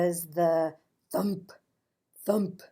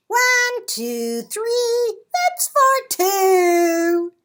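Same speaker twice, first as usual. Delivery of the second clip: loud, high, rowdy counting; louder and higher count; boisterous counting; 11 semitones higher, 8 dB louder, slower than usual. A narrator's reading. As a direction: call the count like a happy klutz. Tacky's clumsy march count.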